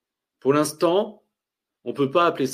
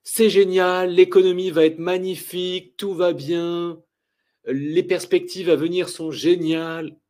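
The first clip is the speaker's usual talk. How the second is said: mock euphoria; 4 semitones higher than usual. Trader voices the over-optimistic public about the economy, in mock euphoria. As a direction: imitate people blissfully sure that all is well. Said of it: "là, leur sentiment".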